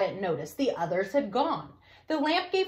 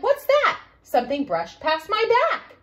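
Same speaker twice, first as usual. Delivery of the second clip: startled; 8 semitones higher, 5 dB louder than usual. Acted. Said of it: "when Grace said".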